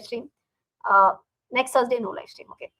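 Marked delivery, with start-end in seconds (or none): none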